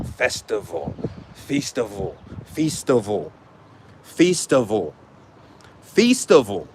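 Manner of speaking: "festival" said slowly as FEES-ti-val; "festival" drawn toward "feast-ival", set apart; foreign-accented word. Shown as a demonstration. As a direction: sound out "festival" with a long ee in the first syllable. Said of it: "saying festival in a foreign accent".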